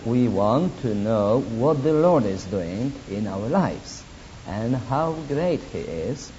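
normal talking voice

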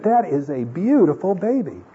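high-pitched, emphatic quoted praise; admiring exclamation. Retold or imitated. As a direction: marvel at the baby, pitch swooping up. Retold admiring remark about baby.

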